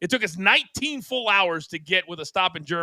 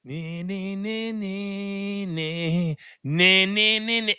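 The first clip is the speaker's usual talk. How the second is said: hummed Baywatch theme; 2 semitones higher, much slower than usual. Retold or imitated. Imitating Baywatch music while sprinting with the Red Bull, hummed. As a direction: hum the slow-motion running music. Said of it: "like I'm on Baywatch".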